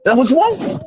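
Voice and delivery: a high, loud, rising dare to fight; hyped challenge. Retold or imitated. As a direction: bring it on, pumped up. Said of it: "You know my response is".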